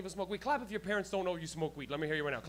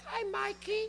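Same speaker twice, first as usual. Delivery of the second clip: put-on high-pitched mom greeting her kid; high mock-mom voice; immigrant mom; 12 semitones higher, slower than usual. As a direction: imitate an accented mother, pitched way up. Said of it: "I have immigrant moms".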